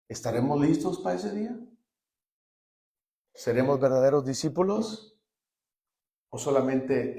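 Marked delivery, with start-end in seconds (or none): none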